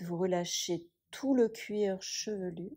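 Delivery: ordinary talk, nothing marked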